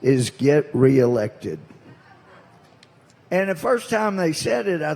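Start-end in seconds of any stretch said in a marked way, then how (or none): 0.0-1.6 slow, louder, drawn-out punchline; punchline stress. Retold or imitated. Orientation speaker's advice delivered as slow punchline. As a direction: land the line slowly after a pause. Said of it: "wait for it".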